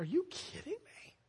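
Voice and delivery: put-on high, disbelieving teenage whine; can't believe the injustice; incredulous kid protest. Thirteen-year-old boy protesting being grounded, incredulous.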